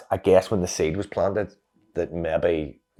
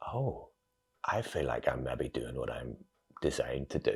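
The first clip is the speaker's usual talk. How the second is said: hushed inner thought; 4 semitones lower, 9 dB quieter, slower than usual. Retold or imitated.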